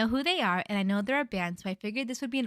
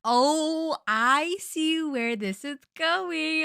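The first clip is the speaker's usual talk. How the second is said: knowing drawl; 7 semitones higher, 5 dB louder, much slower than usual. Real, not acted. Reader reacts knowingly to story.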